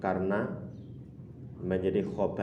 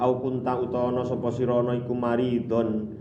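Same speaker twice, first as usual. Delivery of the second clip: raised, lilting recitation of the gloss; a touch louder kitab chant; 5 semitones higher, 5 dB louder than usual. Acted. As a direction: recite it like reading the kitab aloud. Kitab text recited again.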